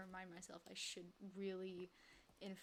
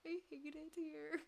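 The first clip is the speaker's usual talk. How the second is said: character's voice; 8 semitones higher than usual. Acted. Streamer trying on the character's voice, high and put-on. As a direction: voice the game character's complaint. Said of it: "It's so hard to do her voice".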